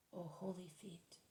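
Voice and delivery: very soft, slow, near-whispered reverence; hushed adoration. A narrator's reading. Mary kisses Jesus' feet, speaking in hushed adoration. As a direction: murmur it slowly and tenderly.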